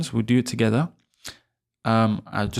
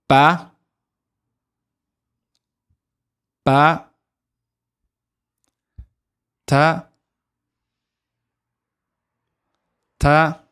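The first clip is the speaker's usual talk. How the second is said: high, loud, drawn-out letter names for repeating; drilling letters; 5 semitones higher, 4 dB louder, much slower than usual. Shown as a demonstration. Teacher drilling the alphabet for students to repeat. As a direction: call each letter clearly for the class. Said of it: "So repeat after me".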